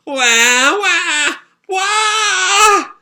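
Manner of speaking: high, loud mock baby wails; imitated baby crying. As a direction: wail like newborns. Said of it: "the babies start crying".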